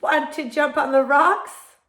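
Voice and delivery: high, loud goat voice; goat character. A narrator's reading. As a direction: call out an invitation as the bleating goat. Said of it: "said the goat".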